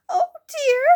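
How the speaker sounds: high, loud sobbing little fairy. Acted. Silky sobs to the children about Moonface, high and tearful. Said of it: "sobbed Silky".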